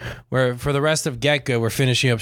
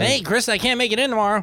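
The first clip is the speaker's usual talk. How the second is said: high put-on voice; prank call voice; 10 semitones higher, faster than usual. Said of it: "I'm gonna prank call".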